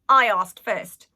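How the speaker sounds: cheeky retort. A narrator's reading.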